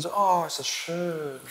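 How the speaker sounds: soft, slow, dreamy mock contentment; hammed-up bliss at an easy stretch; mock blissful sigh. Mocking comfortable, easy exercise. (gespielt wohlig, leise und langsam)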